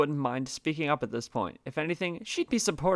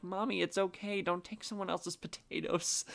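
put-on little girl voice; 4 semitones higher, 6 dB quieter than usual. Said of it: "the poor little girl was like".

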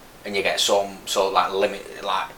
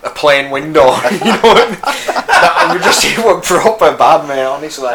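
laughing through the words, louder and higher; 7 semitones higher, 13 dB louder than usual. Real, not acted. Laughing as he tells the interviewer about the helicopter drill. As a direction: laugh it off while telling a scary memory.

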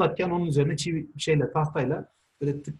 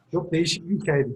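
ordinary talk

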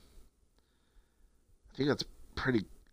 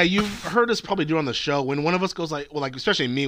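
ordinary talk, nothing marked

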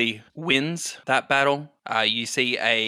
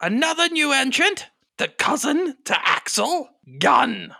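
arena announcer. Acted. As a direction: hold a high pitch and stretch the introduction. Game master voices arena announcer.

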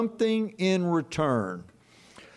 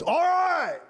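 giving-in dad; 13 semitones higher than usual. Retold or imitated. Dad gives in.

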